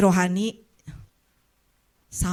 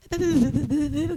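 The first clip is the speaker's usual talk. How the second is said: mock gossip mutter; 6 semitones higher than usual. Mimicking gossipers again.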